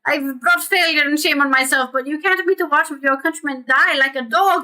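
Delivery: lightly hammed-up desperate appeal; begging merchant. Reads Verzano, lip trembling, begging.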